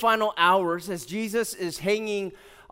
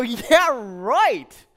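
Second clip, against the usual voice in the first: scoffing; 3 semitones higher, 7 dB louder than usual. Retold or imitated. [name] reading a prize message in his inbox, scoffing. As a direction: blurt out exaggerated disbelief. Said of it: "And I read this, I go".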